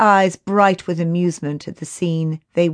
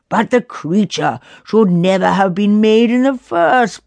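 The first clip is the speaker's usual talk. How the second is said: arguing; 3 semitones higher, 4 dB louder than usual. A narrator's reading. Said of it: "argued Lockian".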